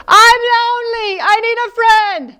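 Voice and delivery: high mock-tearful echo; the echo repeating the plea. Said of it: "From the other side of the pond".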